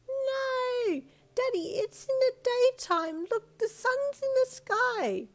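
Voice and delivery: put-on high, loud, slow child protest; child arguing it's daytime; insistent little girl. A narrator's reading. Daughter protesting bedtime to dad, loud child voice. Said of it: "she replied emphatically".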